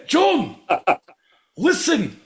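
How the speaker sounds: Scottish man yelling about a Welshman who ran away; angry Scottish rant. Acted. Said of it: "That's what my Scottish should have been".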